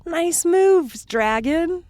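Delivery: bright-pitched, mock cheering shout-out; little cheer. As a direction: call up a cheer to a friend. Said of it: "Give them a little cheer".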